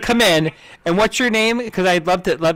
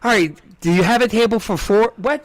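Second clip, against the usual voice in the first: mock flustered diner; 8 semitones higher than usual. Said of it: "you're like".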